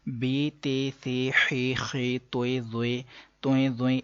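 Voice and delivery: بے، تے، سے said the Urdu way; the common Urdu-style reading; Urdu letter names. Shown as a demonstration. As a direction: read توئے، زوئے the Urdu way, not Arabic. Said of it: "ایسا آپ نے سنا ہوگا".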